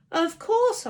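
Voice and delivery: high, loud sharp retort; embarrassed boy snapping at Tina; snapped. A narrator's reading. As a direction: snap it back. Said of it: "I snapped".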